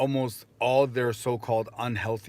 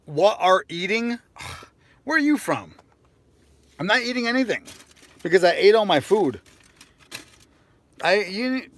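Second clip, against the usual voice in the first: high, loud retort; 8 semitones higher, 8 dB louder than usual. Real, not acted. Reacting to chat, exaggerated voice.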